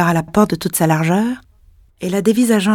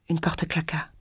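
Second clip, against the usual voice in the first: chuchoté; 9 dB quieter than usual. A narrator's reading. Tension du récit, chuchoté.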